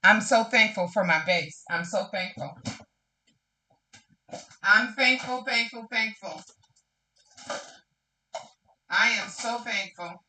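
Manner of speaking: soft, breaking repeated thanks; barely holding it together; tearful gratitude. Real, not acted. Thanking God for her friends, soft and tearful. Overcome with gratitude, near tears.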